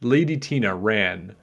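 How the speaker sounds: ordinary delivery, nothing marked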